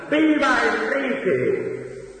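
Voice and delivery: high, slow triumphant cheer; jubilant cry. Acted. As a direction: ring it out like a victorious crowd. Victors shouting for Enrique.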